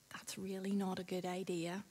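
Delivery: soft whisper of a little inner voice. Acted. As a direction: whisper it like a conscience. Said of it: "voice in our head might sa".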